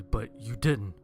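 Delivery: plain reading voice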